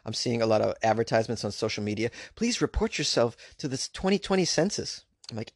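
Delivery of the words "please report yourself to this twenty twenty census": higher-pitched mock advert voice; mock census announcement in a lifted voice; pleading census advert. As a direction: imitate a nagging public appeal, pitched up.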